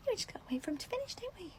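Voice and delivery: fast, high whispered aside; hurried conspiratorial whisper. Acted. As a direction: whisper it quickly so he can't hear. Hushed aside while cleaner nearby.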